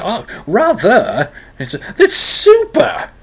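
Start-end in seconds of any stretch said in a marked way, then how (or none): 0.0-1.3 high, drawn-out upper-crust English; mock posh English, slow and swooping; upper-crust English accent. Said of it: "upper crust English accent". 2.0-3.1 very high, loud, drawn-out posh English; gushing mock upper-class English. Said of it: "upper crust English accent".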